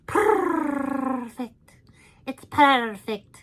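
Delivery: a second, better try at the diva purr; glamorous star savouring the word. Shown as a demonstration. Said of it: "That's more like [name]".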